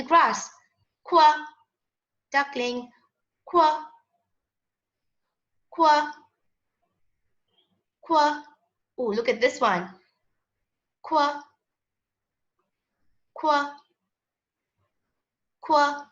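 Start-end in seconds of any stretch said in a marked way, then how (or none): 1.0-1.4 "Quack" as a loud, higher "kwa"; punchy single "kwa" for "Quack"; Q sound demo. Shown as a demonstration. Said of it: "we will make the quack sound". 3.4-8.4 "Quack" as a short, higher "kwa"; evenly spaced single "kwa" for "Quack"; Q sound demo. Shown as a demonstration. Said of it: "we will make the quack sound". 11.0-16.1 "Quack" cut down to a single "kwa"; one falling "kwa" for "Quack"; Q sound demo. Shown as a demonstration. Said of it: "we will make the quack sound".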